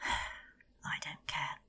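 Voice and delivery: slow, whispered and drained; queen, numb, tells the guard she doesn't care; weary whisper. Acted. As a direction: let it fall out after a sigh.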